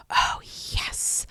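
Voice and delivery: hushed, airy whisper; breathy whisper. Acted. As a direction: breathe it out greedily. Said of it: "liaison whispers breathily".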